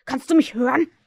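loud call into distance; shouted question. A narrator's reading. Hook calls to the unseen spirit, shouted question. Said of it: "schrie er".

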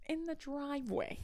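high, soft repeat of the punchline; hushed punchline. Retold or imitated. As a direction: imitate him dropping it softly for effect. Imitates his hushed punchline repeat.

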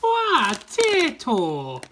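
put-on high, loud Irish accent, slow. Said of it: "it came out slightly like this 'cause it's Irish related".

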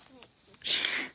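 loud, slow, hissing snake voice; drawn-out s like a snake. Pretend. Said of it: "You sound like a snake".

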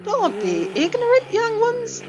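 high voiced scolding old dog; old terrier voice. A narrator's reading. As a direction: lecture the youngsters from above. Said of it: "said the terrier".

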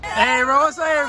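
loud, high hype shout; shouting greeting. Real, not acted. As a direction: yell it across the room.

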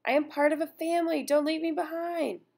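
high, pleading cry, slowing at the end; desperate scream. Acted. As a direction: beg not to be left behind. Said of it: "I hear someone scream".

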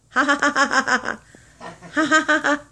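staccato spoken ha-ha, high; demo laugh for bird. Shown as a demonstration. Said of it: "Are you gonna laugh?".

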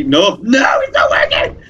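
high-pitched panicked yelp; frantic dismay. Real, not acted. Gamer to viewers as it stops working, frantic dismay. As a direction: cry out as it all fails. Game goes wrong mid-play.

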